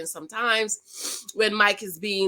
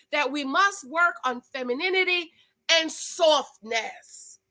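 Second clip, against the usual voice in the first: usual speaking voice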